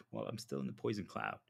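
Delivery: lightly put-on low voice; mock gloomy disciple. Disciple still in the poison cloud explaining, mildly mocking low voice. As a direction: drop low as a disciple who is stuck. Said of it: "They're not gonna talk like this".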